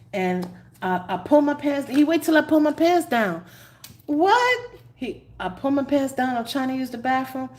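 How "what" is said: very high, loud exclamation; mock shock. Telling the bathroom water bug story, high mock shock. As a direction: yelp it in disbelief.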